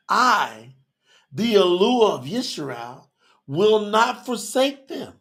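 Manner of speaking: slow, measured proclamation with long pauses; solemn scripture reading. Acted. Preacher reading verse seventeen to listeners, slow and solemn. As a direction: read it out slowly, weighting each phrase.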